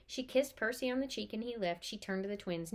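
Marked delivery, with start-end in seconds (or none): none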